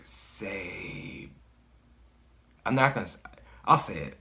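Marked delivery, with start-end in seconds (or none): 0.0-1.3 low, soft, drawn-out eerie whisper; whispery entity voice. Retold or imitated. Hooded figure commands the dreamer, eerie hushed voice. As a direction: draw it out quietly, like the figure. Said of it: "it said to me, it was like".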